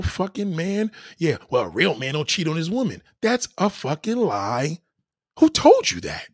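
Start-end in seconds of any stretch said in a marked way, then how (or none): 5.4-6.3 higher, louder challenge; incredulous outburst. Real, not acted. Ranting host challenging a viewer, louder and higher. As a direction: fire the question back, louder. Heated rant rebuttal.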